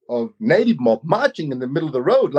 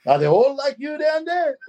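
put-on high, playful old uncle voice; old uncle ribbing a young man at dinner, high and playful; teasing old uncle; 12 semitones higher than usual. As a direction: tease him like a cheeky elder. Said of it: "He goes".

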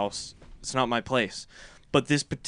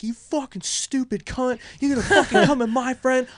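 furious yelling; 11 semitones higher, 5 dB louder than usual. Retold or imitated. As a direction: let her have it, voice pitched up. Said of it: "Just went off".

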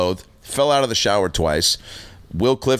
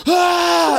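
big victorious yell, pitched way up; triumphant shout; 5 dB louder than usual. Retold or imitated. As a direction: roar it like you just won. Holds up caught wine glass.

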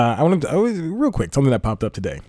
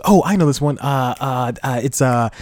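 higher, halting fumble for the answer; stumped contestant; 4 semitones higher than usual. Acted. Guy auditioning for Beat Shazam, acting stumped and flustered. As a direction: act out searching frantically for a song title. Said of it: "He goes".

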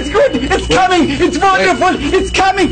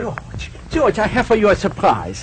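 hypnotist doctor; 9 semitones lower than usual. Acted.

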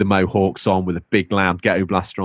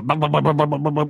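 higher chanted bum bum rivet beat; rivet gun noise; 7 semitones higher than usual. Retold or imitated. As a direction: hammer out the rivet rhythm. Rivet gun sound again.

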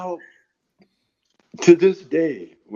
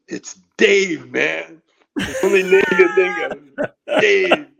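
stoner voice; 6 semitones higher, 4 dB louder than usual. Retold or imitated. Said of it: "now he goes".

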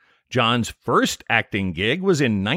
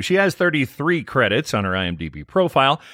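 usual speaking voice

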